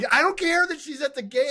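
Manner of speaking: high-pitched exasperated outburst; mock-whiny exasperation; exasperated protest. Exasperated outburst about game coverage.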